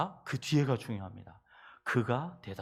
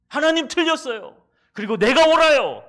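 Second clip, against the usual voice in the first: loud, high shout of defiance; defiant shout; 13 semitones higher, 17 dB louder than usual. Acted. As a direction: rebel against God at full voice. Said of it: "Jonah defying God". (하나님께 대드는 요나, 큰 소리로)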